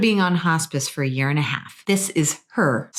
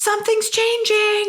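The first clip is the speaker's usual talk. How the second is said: much higher urgent call out. Retold or imitated. Brother calling sister back in, high and urgent. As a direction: call her back in at once. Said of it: "called me back in going".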